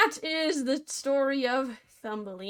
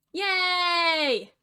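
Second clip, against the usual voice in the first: higher, loud mock cheer; 6 semitones higher, 5 dB louder than usual. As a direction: cheer with fake glee. Sarcastic cheer at sad line.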